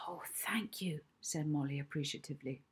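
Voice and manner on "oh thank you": soft whispered thanks; whispering. Acted. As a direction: breathe the thanks out.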